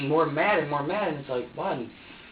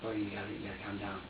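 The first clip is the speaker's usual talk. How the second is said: put-on softer, coaxing the crying baby to calm down; soft soothing to baby; 5 semitones lower, 10 dB quieter, faster than usual. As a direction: hush him gently, lower and softer. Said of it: "It's like".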